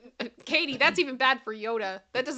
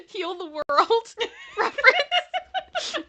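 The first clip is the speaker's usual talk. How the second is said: high-pitched laughing question; 11 semitones higher, 8 dB louder, slower than usual. Real, not acted. Laughing to her cohost about the song. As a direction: laugh through the words.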